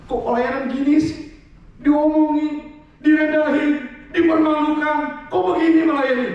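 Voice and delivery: loud, high mock wail; hammed-up crying protest, held high; tearful complaint. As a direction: whine like an aggrieved church worker in tears. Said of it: "Iya, nangis-nangis nggak?".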